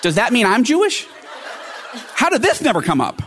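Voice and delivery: amused disbelief at family news; laughing disbelief. Retold or imitated. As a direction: laugh as the shock sinks in.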